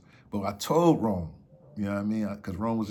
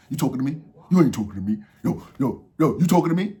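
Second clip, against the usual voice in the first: mock-tough challenge, building louder; tough-guy front; 4 semitones higher, 8 dB louder, faster than usual. Uncle putting his tough act on at the runner, mock challenge. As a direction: keep challenging him, louder as it goes. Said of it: "He just put his tough shit on".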